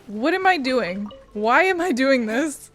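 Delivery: ordinary talk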